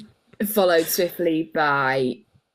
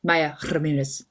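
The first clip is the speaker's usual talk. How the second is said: lower, lightly put-on Spanish accent; imitated Spanish accent; 4 semitones lower, 3 dB quieter than usual. Co-host echoing the presenter's accent attempt, mock Spanish accent. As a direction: mimic the name in a Colombian Spanish accent.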